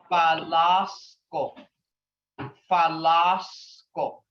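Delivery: Falasco, each syllable slow and clear; slow sounded-out Muskogee word. Shown as a demonstration. Teacher sounding out Falasco for the class. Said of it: "and it's F-A".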